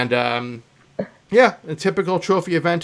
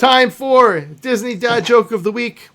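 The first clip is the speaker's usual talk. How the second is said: announcer; 9 semitones higher, 7 dB louder, slower than usual. Acted. Announces segment.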